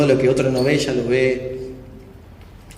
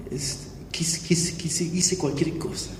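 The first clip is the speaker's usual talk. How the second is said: softer, breathless, whispered confession to God; 2 semitones higher, 6 dB quieter, slower than usual. Retold or imitated. Confessing to God alone in his room, a whisper. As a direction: barely voice it, broken and ashamed. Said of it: "me largué a llorar".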